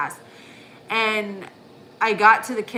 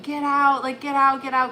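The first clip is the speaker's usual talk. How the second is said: raised, urgent shout of get out; calling the kids out of a smoky house, higher and louder; urgent alarm, pitched up and loud; retold yell for the kids; 6 semitones higher, 6 dB louder than usual. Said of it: "I'm screaming for the kids".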